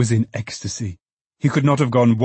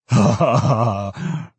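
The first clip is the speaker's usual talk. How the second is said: hearty, drawn-out acted belly laugh; roaring laughter; 4 dB louder, slower than usual. A narrator's reading. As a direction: roar with laughter as the old father. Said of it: "roared Toby".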